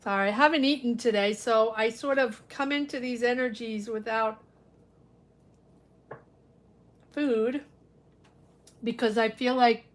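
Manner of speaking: talking while chewing banana; mouth full. Real, not acted. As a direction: speak through a mouthful. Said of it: "I don't wanna eat with my mouth full".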